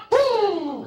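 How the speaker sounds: very high whoop of triumph; high celebratory woo. Retold or imitated. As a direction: whoop as the fry comes out perfect. Cook's cry of triumph.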